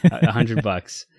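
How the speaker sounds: "hundred bucks" said slowly and firmly; emphatic correction. Real, not acted. Answering host's bucks-or-yen joke, emphatic "hundred bucks".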